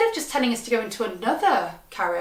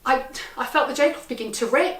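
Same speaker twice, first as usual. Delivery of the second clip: ordinary delivery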